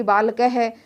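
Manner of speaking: बालकः ending in a breathy ह; visarga sounded. Shown as a demonstration. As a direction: let the ह after बालकः be heard. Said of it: "है की sound आ जाएगी". (विसर्ग वाला बालकः)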